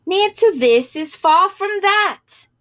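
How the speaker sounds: slow, loud, high bellow. A narrator's reading. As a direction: boom it right into his ear. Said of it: "He bellowed in my ear".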